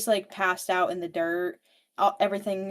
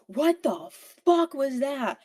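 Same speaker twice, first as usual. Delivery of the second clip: higher, slow shocked question; startled outburst; 6 semitones higher, 3 dB louder than usual. Retold or imitated. [name] reacts to the plants tunnel, slow startled disbelief. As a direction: drag out the shock.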